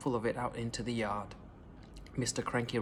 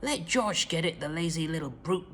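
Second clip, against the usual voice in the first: scornful shout; 3 semitones higher than usual. A narrator's reading. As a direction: sneer at the lazy boy. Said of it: "Grandma shouted".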